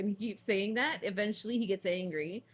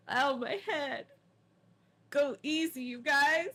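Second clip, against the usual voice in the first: mock pained complaint; 7 semitones higher, 4 dB louder than usual. Character hurt in battle.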